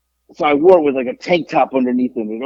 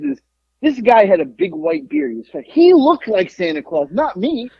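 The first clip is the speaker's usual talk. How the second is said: ordinary talk, nothing marked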